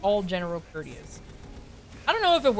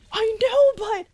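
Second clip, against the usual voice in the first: much higher, louder freaked-out imitation; high loud squeak; 7 dB louder, slower than usual. Tess freaking out at the news, loud high squeak. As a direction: squeak it out in a panic.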